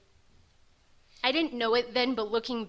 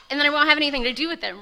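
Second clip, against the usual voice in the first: higher and louder, racing with spite; angry outburst; 4 semitones higher, 6 dB louder than usual. Retold or imitated. Vowing to get sober just to spite family, fast and angry. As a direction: spit out the vow fast and furious. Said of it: "but super angry".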